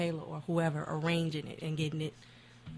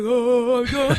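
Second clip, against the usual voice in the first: mock dude voice; 7 semitones higher, 13 dB louder than usual. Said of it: "'Cause I be like".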